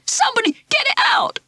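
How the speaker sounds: put-on high, panicked boy's shout. Ralph to the class, shouting in panic as the mouse climbs. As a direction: yelp for help in terror. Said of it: "he shouted".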